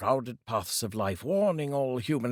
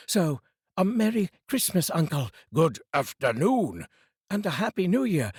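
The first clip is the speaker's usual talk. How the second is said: high, louder festive farewell; cheerful parting wish; 8 semitones higher, 4 dB louder than usual. A narrator's reading. The nephew wishing his uncle merry Christmas as he leaves. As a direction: send it off brightly despite him.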